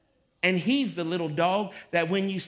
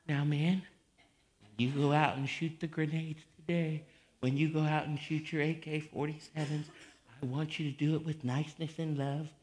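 soft, slightly lowered mock-nice orders; mild-mannered army leader; 4 semitones lower, 6 dB quieter than usual. Preacher imagining a nice army leader briefing troops, mock-gentle voice. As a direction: give battle orders ever so gently. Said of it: "just sits there and say".

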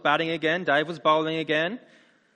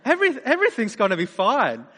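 mock cocky relief; 7 semitones higher, 8 dB louder than usual. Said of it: "It's like".

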